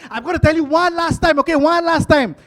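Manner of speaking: high, loud final warning; acted wife at the end of her patience; losing it. Wife warns husband one last time, high angry yelling. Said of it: "She lost it".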